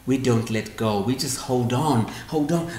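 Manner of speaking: ordinary talk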